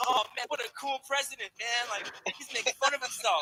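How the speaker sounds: high, mock-admiring voice; gushing fan parody. Public admiring the president's joke, high mock gushing. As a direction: gush like an easily charmed voter. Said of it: "And then we all thought".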